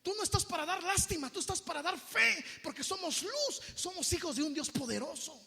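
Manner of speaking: high, loud preaching shout; rally the faithful with full force; rousing exhortation. Acted.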